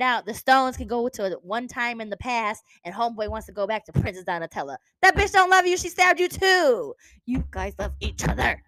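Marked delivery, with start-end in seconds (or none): 5.0-8.6 loud frustrated rant; angry rant. Real, not acted. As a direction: yell at the character. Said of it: "very angry at this point".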